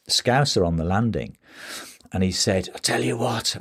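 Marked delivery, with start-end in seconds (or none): 2.7-3.6 a first, half-hearted try at a Scouse voice, a bit higher; half Liverpool accent. Retold or imitated. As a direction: start the Scouser's line with only a hint of Liverpool accent. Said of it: "I'm not gonna do a particularly good Liverpool accent".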